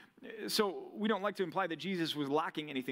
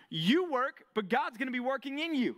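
emphatic point; 8 semitones higher, 4 dB louder than usual. Real, not acted. Preacher driving home a point.

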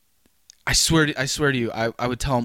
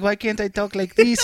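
higher-pitched mock Mexican accent; exaggerated Mexican accent; 6 semitones higher than usual. Needling his Mexican coworker, mock Mexican accent. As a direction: tease with a put-on Mexican accent. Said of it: "I'm like".

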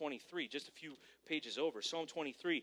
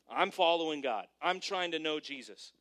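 normal talking voice

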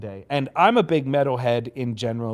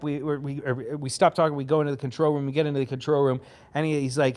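usual speaking voice

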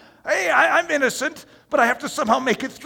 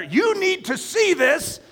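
higher, slow insistent push; urging enemy voice; 10 semitones higher, 3 dB louder than usual. Acted. Enemy pushing the believer to look, insistent. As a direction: press him to look.

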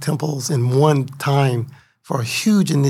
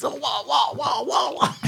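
wild dance cries; 9 semitones higher, 6 dB quieter, slower than usual. Retold or imitated. Imitating devotee's wild dance sounds.

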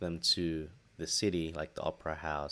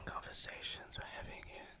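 unvoiced dramatic whisper; 12 dB quieter than usual. Real, not acted. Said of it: "Why are you whispering?".

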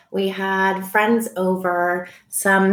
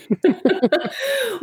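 bright giggle; high laugh; 14 semitones higher than usual. Real, not acted. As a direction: break into a giggle. Laughs at own question.

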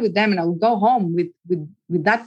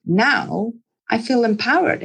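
ordinary talk, nothing marked

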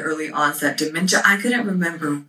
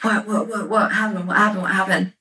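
retold alarmed questioning; 4 semitones higher, faster than usual. Imitates alarmed boyfriend questioning her rage.

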